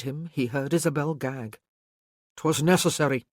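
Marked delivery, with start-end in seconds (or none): 2.4-3.3 curt. A narrator's reading. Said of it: "he said curtly".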